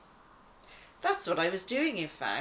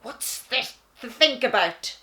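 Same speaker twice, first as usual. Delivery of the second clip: loud, high growling lioness; angry lioness growl, louder; 4 semitones higher, 6 dB louder than usual. Acted. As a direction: snarl it at your brother. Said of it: "she growled".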